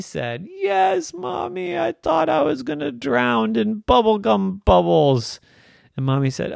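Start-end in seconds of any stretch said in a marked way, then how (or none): 0.5-5.4 put-on very high little-kid wail, easing down; upset child. Irene telling Mommy she nearly drowned, upset. Child complains of her ordeal.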